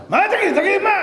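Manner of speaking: high, loud impatient outburst; exasperated demand. Acted. Friend fed up with the laughing man, exasperated shout. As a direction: cut in, demanding an answer.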